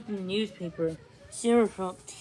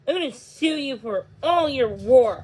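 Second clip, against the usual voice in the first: angry threat; 5 semitones higher, 9 dB louder than usual. A narrator's reading. Owen threatening after quarrel.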